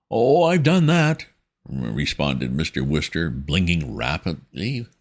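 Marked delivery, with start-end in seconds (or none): 0.1-1.3 high, slow, fumbling toff; flustered young gentleman. A narrator's reading. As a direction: stammer like a rattled young gent. Wooster voiced in story.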